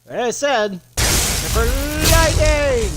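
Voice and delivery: building to a high yell; excited shout. Acted. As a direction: shout lightning triumphantly. Hyped shout about lightning.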